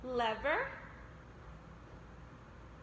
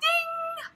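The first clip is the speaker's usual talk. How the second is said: high squealed ding and gasp; oven ding; 12 semitones higher, 3 dB quieter than usual. Pretend. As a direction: ring out like a timer. Oven timer in pizza game.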